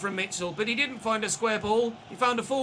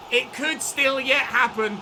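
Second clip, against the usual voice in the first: normal talking voice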